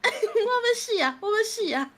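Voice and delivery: hammed-up cry of imminent death; mock dying panic. Gamer crying out to teammate while dying, mock panic.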